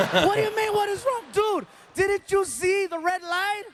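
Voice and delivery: high shouting at the driver, slowing down; outraged. Retold or imitated. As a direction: shout back in disbelief. Retold outraged reply.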